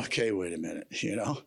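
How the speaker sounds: a hint of weary God reasoning; exasperated God. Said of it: "the Lord's probably kinda going".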